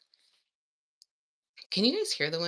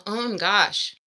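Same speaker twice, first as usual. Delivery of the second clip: loud, slow oh my gosh; loud surprised exclamation; startled; 2 semitones higher, 11 dB louder, slower than usual. Real, not acted. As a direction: caught off guard by the pain. Throat clearing hurt her.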